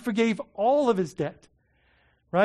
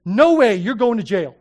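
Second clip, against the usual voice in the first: much louder mock refusal; harsh refusal; 3 semitones higher, 11 dB louder than usual. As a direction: bark the refusal at the debtor. Servant refuses the debtor.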